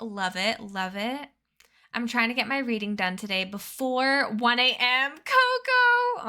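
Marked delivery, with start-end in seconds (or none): 5.1-6.3 high, loud happy call; excited greeting. Real, not acted. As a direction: call out to Coco with delight.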